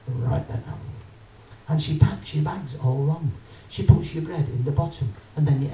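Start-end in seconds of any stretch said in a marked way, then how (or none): 0.1-0.6 sulky cashier. Retold or imitated. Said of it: "she goes".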